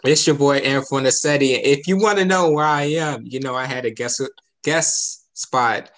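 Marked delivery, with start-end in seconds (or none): 0.1-3.2 loud radio DJ intro; radio host. Acted. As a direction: hype it like a DJ.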